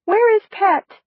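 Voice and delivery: a small voice insisting on an answer; high reedy demand. Acted. New body demanding to know where Pet is, high and reedy. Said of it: "my high, reedy voice demanded".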